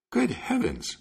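amazed miller, high then falling; astonished exclamation. A narrator's reading. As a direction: exclaim in wonder, pitch shooting up. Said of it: "exclaimed the miller".

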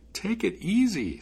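high, slow, drawn-out student voice; slow the threat down, pitched high; unafraid student stalling. Acted. Student stalling the furious spirit, unruffled.

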